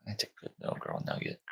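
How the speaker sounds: unvoiced, tender whisper to a puppy. Real, not acted. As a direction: whisper sweetly to the newborn. Cooing to newborn puppy.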